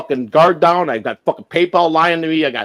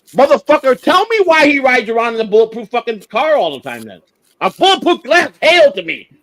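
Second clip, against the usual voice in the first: raised, heated outburst, higher pitch; angry rant; 8 semitones higher than usual. Real, not acted. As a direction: tear into the Pope, voice climbing high. Angry rant about the Pope.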